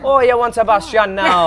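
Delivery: high, loud Caribbean crab voice; playful Sebastian impression. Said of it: "Do a little Sebastian".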